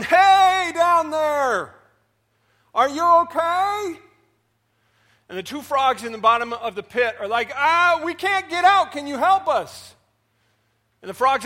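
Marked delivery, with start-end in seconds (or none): 0.0-4.0 high, loud call down into the pit; calling down a hole. Acted. Frogs at the rim calling to frogs below, loud and high. 7.5-9.9 high, loud plea for help; trapped frogs calling up. Acted. Frogs in the pit calling up for help, high and loud.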